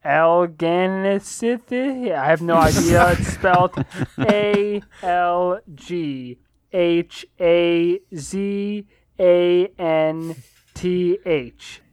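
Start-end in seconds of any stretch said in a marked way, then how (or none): 0.0-2.1 Alganisithi said slowly and haltingly as a guess; unsure pronunciation. Shown as a demonstration. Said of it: "I don't know how to pronounce this right". 4.2-11.8 slow letter-by-letter spelling. Shown as a demonstration. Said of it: "then I'll spell it for you guys".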